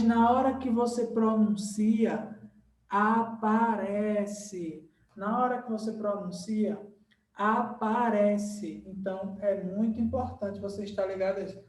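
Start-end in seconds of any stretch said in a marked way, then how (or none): 2.9-4.9 "aparece" stretched very slowly, syllable by syllable; drawn-out emphasis. Shown as a demonstration. Teacher stresses what appears in speech. 7.3-8.8 "aparece" very slow, every syllable held; drawn-out emphasis. Shown as a demonstration. Teacher repeats the point for emphasis.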